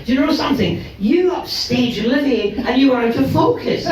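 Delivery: markedly high-pitched acted quote; imitated astonished friend. Friend's remark acted in the anecdote.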